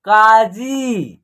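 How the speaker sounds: काजी very slowly, both vowels held; long vowels. Shown as a demonstration. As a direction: stretch the final ī of काजी. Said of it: "खींच करके होगा".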